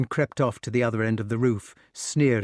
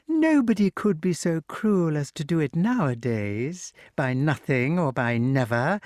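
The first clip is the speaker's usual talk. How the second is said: higher cackling hag voice; gloating witch; 7 semitones higher than usual. A narrator's reading. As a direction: relish the cruelty.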